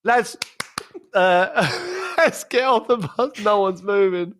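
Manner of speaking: half-laughing plea to get off the bus; imitating the assistant. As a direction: assistant coach begging the players. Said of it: "he's going".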